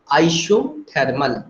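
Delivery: Isothermal drawn out slowly, syllable by syllable; slow teacherly repetition of isothermal; slow spelled-out term. Shown as a demonstration. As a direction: say isothermal slowly so students catch each syllable.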